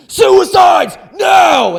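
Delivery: furious coach's scream at full force; very loud, high bellow. Retold or imitated. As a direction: scream it like a drill command. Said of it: "he just screamed".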